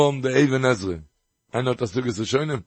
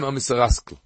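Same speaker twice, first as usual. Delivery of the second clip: ordinary talk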